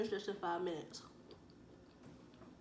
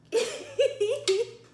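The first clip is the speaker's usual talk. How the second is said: burst of laughter; 12 semitones higher, 10 dB louder than usual. Real, not acted. Laughs at own mistake.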